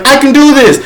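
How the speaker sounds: loud, high eager boast; volunteer it with gusto. Retold or imitated. Firefighter offering to deliver the baby, loud eager. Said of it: "The firefighter's like".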